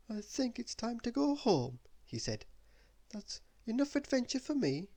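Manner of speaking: a touch of nervous relief; Mister Worry. Acted.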